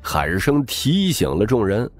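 usual speaking voice

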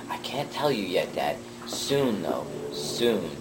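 soft, mysterious promise; secretive. Acted. Son refusing to explain to dad, softly mysterious.

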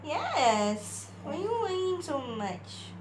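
high, loud mock exasperation at the dog; talking to a whining dog, high and exasperated. Real, not acted.

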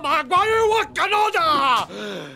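high, drawn-out shout; impassioned cry. Acted. Warrior calling out Wakanoda, high impassioned shout. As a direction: cry out at full voice, falling away at the end.

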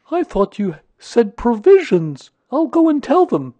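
high, loud, happy bear voice; pleased, eager character voice; Pooh, happy. A narrator's reading. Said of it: "said Pooh happily".

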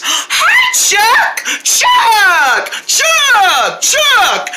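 high-pitched, squealed calls; overjoyed greeting. Acted. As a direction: cry the friend's name in hammed-up delight.

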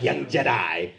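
put-on low, soft, sinister old ruler; hushed villain impression; Emperor from Star Wars. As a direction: croon it low like a dark lord. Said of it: "looks like the Emperor from Star Wars".

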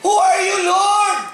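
high, loud cry to the Lord. Acted. Paul struck down cries out to the Lord, loud and high. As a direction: call out in awe. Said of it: "At ang tanong niya".

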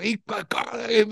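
high strangled words; retold strangled plea; choking gasp.

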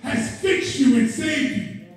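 high, driving refrain building in fervor; exultant preaching. Real, not acted. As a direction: rouse the church with each repeat.

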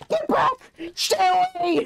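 high panicked shout; frantic yell. Pretend. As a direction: shriek like a scared granny. Panicked old lady yelling.

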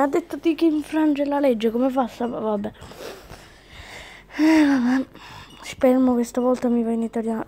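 ordinary talk, nothing marked

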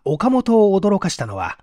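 ordinary delivery, nothing marked

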